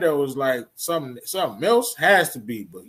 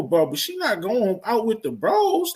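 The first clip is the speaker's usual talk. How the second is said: ordinary delivery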